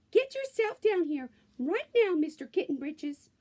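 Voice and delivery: high, loud mother calling him down; stern mother ordering. A narrator's reading. Mother ordering her kitten downstairs, high and loud. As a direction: call the kitten down like a stern mama. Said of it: "ordered Mama Junebug Jones".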